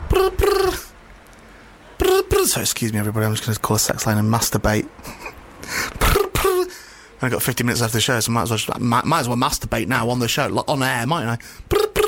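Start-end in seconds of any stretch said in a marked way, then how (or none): 0.1-2.4 high mouthed brr brr of a ringing phone; phone ring imitation. Presenter fakes the dial tone on air, high brr brr. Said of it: "just ringing". 6.0-6.6 high mouthed brr brr of a ringing phone; phone ring imitation. Presenter fakes the dial tone on air, high brr brr. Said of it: "just ringing". 11.7-12.0 high mouthed brr brr of a ringing phone; phone ring imitation. Presenter fakes the dial tone on air, high brr brr. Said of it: "just ringing".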